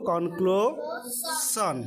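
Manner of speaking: Conclusion stretched very slowly syllable by syllable; teacher stretching the word to show its syllables; slow drawn out. Shown as a demonstration. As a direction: spell out conclusion slowly in sound. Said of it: "C O N".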